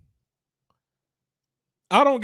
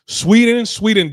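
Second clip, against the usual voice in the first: excited call; 6 semitones higher, 8 dB louder than usual. Real, not acted. Announcing next country on list.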